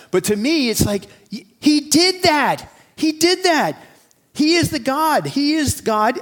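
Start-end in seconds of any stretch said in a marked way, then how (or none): 1.6-6.2 higher and louder, slow and emphatic; preaching at full force. Real, not acted. Preacher proclaiming to his congregation, higher and louder. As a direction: hammer it home from the pulpit.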